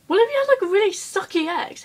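high, loud disbelieving question; incredulous outburst. Real, not acted. Vlogger objecting to a soulmate definition, incredulous outburst. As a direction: burst out high in protest.

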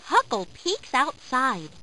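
usual speaking voice

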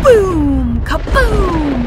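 loud high kaboom; booming thunder. A narrator's reading. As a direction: shout the boom like thunder. Thunder sound effect.

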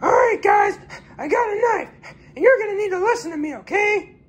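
very high, shouted knife threat; bully them in a shrill cartoon pitch; a knife-wielding stranger in a very high voice; threatening stranger.

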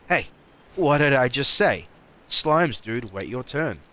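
loud, high-pitched playful telling-off; mock scolding shout. Player scolds slimes to wait their turn, loud mock scolding. Repeats the scolding, far louder.

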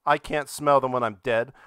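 ordinary delivery

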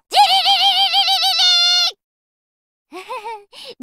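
high ringing alarm clock sound, rolled fast; alarm bell imitation.

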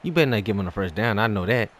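ordinary delivery

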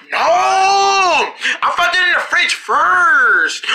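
high, drawn-out "no" in protest; playful protest. Pretend. Player claiming the bacon first, whiny protest. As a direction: whine it out high, stretching the words. Children squabble over bacon.

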